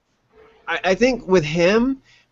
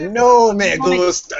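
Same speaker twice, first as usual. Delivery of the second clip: mock Spanish; 5 semitones higher, 4 dB louder, slower than usual. Echoing the Spanish catchphrase.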